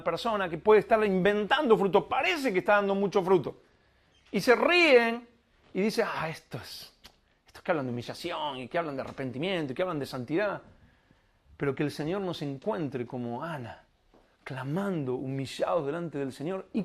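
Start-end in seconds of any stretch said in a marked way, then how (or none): 6.1-10.6 mocking scoffers. Mockers scoffing at humble believers.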